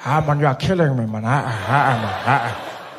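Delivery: put-on groaning, exasperated old man; Nelson Mandela. Old leader appalled at hitchhiking, groaning. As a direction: grumble and groan like a weary elder.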